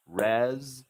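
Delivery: "Reservations" in four pieces, rez, zer, vay, shuns; separated syllables. Shown as a demonstration. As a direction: break Reservations apart, Z sound ending and starting the first two beats. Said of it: "added the Z sounding S twice".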